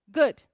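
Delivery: "good" in one firm syllable; one-syllable English "good"; soft final D. Shown as a demonstration. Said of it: "bem suave e ele é uma única sílaba".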